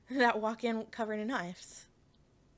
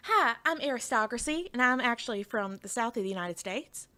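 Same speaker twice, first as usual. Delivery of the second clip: American Southern accent. Shown as a demonstration. Streamer showing off accents on request, American Southern accent. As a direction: introduce yourself in a Southern US accent. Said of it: "Do a Southern accent, like an American Southern accent".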